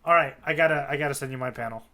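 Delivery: ordinary talk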